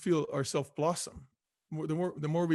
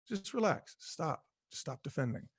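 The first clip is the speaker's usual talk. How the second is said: hushed, much softer plea to let go; soft urging; 8 dB quieter than usual. Acted. Speaker urging listeners to drop their defenses, softly. Soothing self-talk urging surrender.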